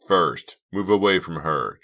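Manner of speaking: firm loud demand. Acted. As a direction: order him off her. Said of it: "Chuck demanded".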